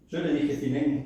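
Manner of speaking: ordinary delivery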